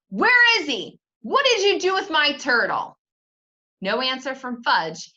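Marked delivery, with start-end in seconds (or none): 0.1-3.0 loud, high demand; yelling. Acted. Peter yelling at Fudge about his turtle, angry. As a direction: yell it at the brother, much higher and louder. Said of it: "I yelled".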